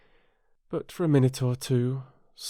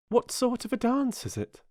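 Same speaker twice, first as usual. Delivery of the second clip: put-on much higher, girlish curious question; curious Alice; 14 semitones higher than usual. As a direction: ask eagerly like a young girl.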